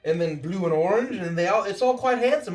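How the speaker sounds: high, admiring character; player's character voice. Acted. Character praising the tailor to friends, high admiring voice. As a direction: praise the work in a high, delighted voice.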